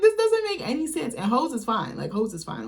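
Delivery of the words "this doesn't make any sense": incredulous. Retold or imitated. Incredulous retold reaction.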